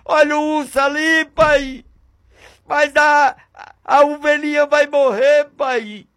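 put-on a frightened young shepherd crying out to his father; scared boy pleading. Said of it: "mesmo com medo, dizendo".

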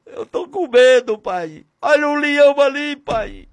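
high, urgent pleas to a father; acted fear, pitched high in quick bursts; frightened son. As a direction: plead like a scared boy calling for dad. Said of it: "Ele vai mesmo com medo, dizendo".

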